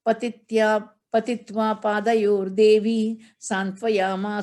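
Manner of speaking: steady chanted Sanskrit recitation; scripture chant in class; verse recitation. Acted.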